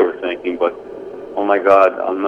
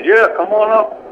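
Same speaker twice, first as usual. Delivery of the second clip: pitched up high, casual and inviting; faked friendly call; 12 semitones higher, 3 dB louder than usual. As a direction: call down the stairs like you're the dealer. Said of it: "this guy's voice says".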